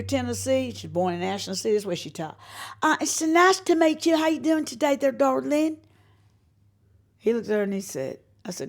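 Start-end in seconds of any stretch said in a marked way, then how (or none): 2.8-5.8 higher, louder sweet Southern lady; Southern sister voice. Retold or imitated. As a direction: gush it like a Tennessee lady greeting a guest. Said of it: "I got a sister out of Pleasant View".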